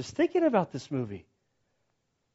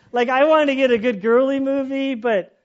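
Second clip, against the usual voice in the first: loud, higher-pitched mock complaint; upset whining; 5 semitones higher, 7 dB louder than usual. Movie watcher complains about the subtitled film, upset. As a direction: act out a let-down moan. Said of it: "like, upset".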